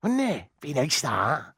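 mock West Country yokel; West Country accent. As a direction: play the West Country local. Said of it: "That's gone a bit West Country".

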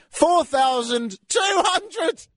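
incredulous. Real, not acted. Outraged at price.

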